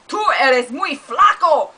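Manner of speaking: mock while giggling; laughing taunt. Cartoon fight continues.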